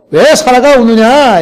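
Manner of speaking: 하나님의 꾸짖음. Retold or imitated. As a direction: let God's question ring out slowly. (느리고 크게 꾸짖는 하나님 목소리)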